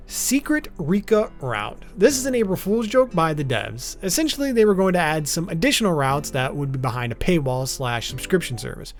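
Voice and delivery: normal talking voice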